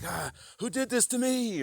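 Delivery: high mock-anguished cry of blame; acted anger. Acting someone mad about their suffering, high outcry. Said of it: "You start getting mad".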